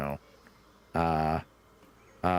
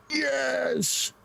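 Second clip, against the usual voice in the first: excited yelp. Real, not acted.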